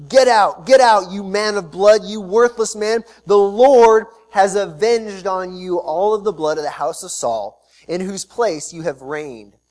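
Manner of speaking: cursing. Acted. Shimei cursing David as he flees, loud angry curse. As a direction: hurl the curse at the fleeing king. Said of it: "Shimei said as he cursed".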